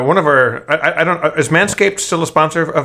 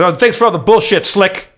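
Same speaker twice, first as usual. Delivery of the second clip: mocking hammed-up brush-off, higher and louder; Mel Gibson line; 7 semitones higher, 3 dB louder than usual. Retelling the film scene, Mel Gibson's parting jab, higher mock sneer. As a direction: sneer it like a smug movie hero. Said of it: "he goes".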